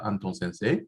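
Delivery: usual speaking voice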